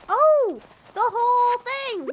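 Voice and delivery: realizing you forgot something; sudden realization. Real, not acted. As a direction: remember it all at once. Suddenly remembers planting.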